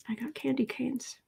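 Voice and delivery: a secret half-voice; whispered aside. Real, not acted. Host sharing a little secret with chat, whispered. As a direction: murmur it under your breath, unvoiced. Private aside during stream.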